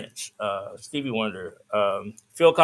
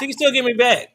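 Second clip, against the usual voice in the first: raised, insistent repeat; emphatic; 12 semitones higher, 6 dB louder than usual. Real, not acted. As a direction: push it over the interruption. Repeats it louder over the host.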